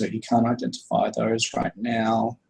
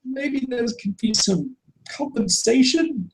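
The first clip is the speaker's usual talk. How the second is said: tearful shopkeeper; 13 semitones higher than usual. Acted. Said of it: "and be like".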